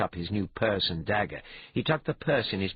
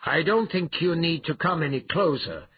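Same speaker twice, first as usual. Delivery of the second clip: raised, higher calling voice; calling back; 9 semitones higher, 4 dB louder than usual. Acted. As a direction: call it across to the brute. Calling back to roaring brute.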